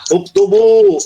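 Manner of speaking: high, emphatic teacher's command UKTUBU; command intonation. Shown as a demonstration. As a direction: call out "Uktubu" as an order, pitched high. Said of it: "gitu kan intonasinya".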